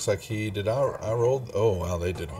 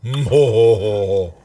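booming brute laugh; big dumb ho-ho laugh; troll laugh; 9 dB louder than usual. Acted.

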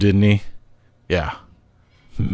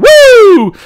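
piercing high whoop of thrill; exultant whoop; 7 dB louder than usual. Acted. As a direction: let out a wild cheer. Whoop after the kill.

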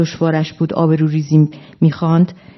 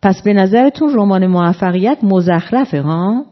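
ordinary delivery, nothing marked

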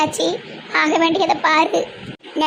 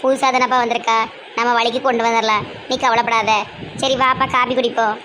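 low, soothing elder sister; older sister voice; 6 semitones lower than usual. Acted. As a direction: speak low and calm to the younger brother. (தம்பியிடம் தாழ்ந்த குரலில் ஆறுதலாகப் பேசும் அக்கா)

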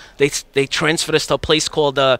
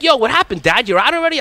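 put-on kids teasing; 8 semitones higher than usual. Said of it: "My kids were like".

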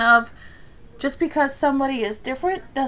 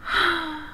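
soft exhaled sigh; wistful sigh; 5 semitones higher, 5 dB quieter than usual. A narrator's reading. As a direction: let out a longing breath. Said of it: "he sighed".

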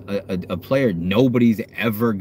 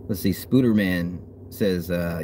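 ordinary talk

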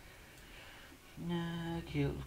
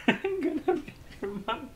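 much higher put-on voice, laughing through it; high mock villain voice breaking into laughter; hammed-up roleplay voice; 4 dB louder than usual. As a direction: ham up the roleplay line, giggling. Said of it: "Roleplay. I like roleplay".

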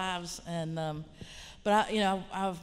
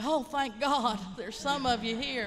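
higher, louder burst of relief; heartfelt, lifted "oh, thank God"; relieved exclamation; 5 semitones higher, 4 dB louder, slower than usual. Retold or imitated. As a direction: exclaim it as if finally finding kin. Retold relief at hearing accent.

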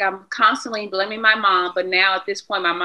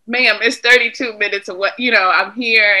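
retold exasperated plea; 4 semitones higher, 7 dB louder than usual. Retold plea to probation officer.

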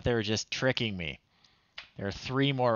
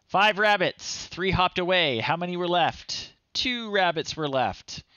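hammed-up excited reading; 8 semitones higher, 4 dB louder than usual. Reading kids' game prompt aloud.

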